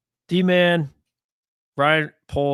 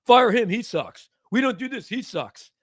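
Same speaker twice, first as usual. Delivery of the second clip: high, dumb mock chant of the critics; 6 semitones higher, 3 dB louder than usual. Host parodying fans who only say fire him, mock chant. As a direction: parrot the lazy fans, mockingly. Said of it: "It's just".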